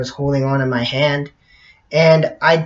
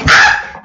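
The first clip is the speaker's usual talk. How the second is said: loud, voiceless "ah!"; mock scream; 6 dB louder than usual. Acting out her imagined reaction, mock scream. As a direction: let out a rough breathy mock scream. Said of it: "And she went".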